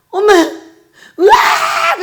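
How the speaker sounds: "엄마" wailed, then a shout "으악!" breaking into a sob; crying outburst. Retold or imitated. Pastor imitating herself wailing at her son, crying out. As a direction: burst out wailing like a hurt mother. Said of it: "하고 울어버릴 때도 있어".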